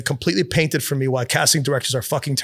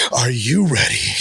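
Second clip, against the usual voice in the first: eerie intense actor; 2 semitones lower, slower than usual. Retold or imitated. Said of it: "Like I was going into the fourth dimension".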